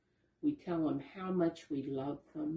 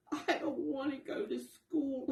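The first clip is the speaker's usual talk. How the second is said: put-on high, pleading child voice; a small raccoon near tears; tearful child; 9 semitones higher than usual. A narrator's reading. Raccoon child begging his mother, tearful. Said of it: "and cried".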